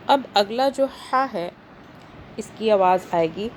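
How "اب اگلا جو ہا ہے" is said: ordinary delivery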